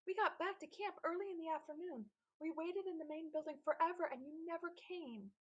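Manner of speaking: put-on complaining kid. A narrator's reading. Antbite complains to Benjamin.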